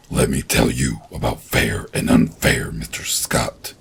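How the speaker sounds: low hissing monster. A narrator's reading. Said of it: "the monster hissed".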